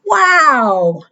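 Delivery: ordinary delivery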